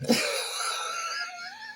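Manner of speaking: shrill burst of laughter; high laugh. Real, not acted. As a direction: cackle at the absurd threat. Laughing at the video.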